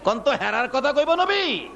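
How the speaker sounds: high-pitched, declaiming sermon voice; impassioned preaching. Real, not acted. Preacher asks the crowd who the rich will be, impassioned. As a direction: preach it out high with long-held endings.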